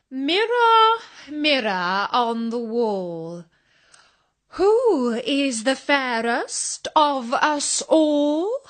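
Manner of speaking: high cry of Mirror, then slow and grand; queen summoning mirror. Acted. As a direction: call on the mirror with slow ceremony. Queen questions her magic mirror.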